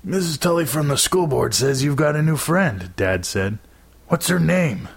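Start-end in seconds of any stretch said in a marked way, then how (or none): 0.0-3.0 high-pitched, playful father voice; teasing dad. A narrator's reading. Dad quizzing his son about a new friend, teasing. 4.1-4.9 high-pitched, playful father voice; teasing dad. A narrator's reading. Dad quizzing his son about a new friend, teasing.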